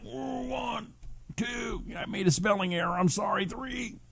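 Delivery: high, soft strained counting; mock push-up strain. Host pretending push-ups as viewer's punishment, mock straining. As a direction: squeeze out each count as if exerting yourself.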